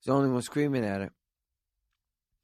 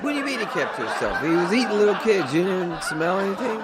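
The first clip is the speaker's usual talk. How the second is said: laughing rant; 9 semitones higher, 5 dB louder than usual. Acted. Comic rant at the neighbor.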